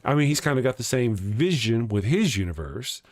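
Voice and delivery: ordinary delivery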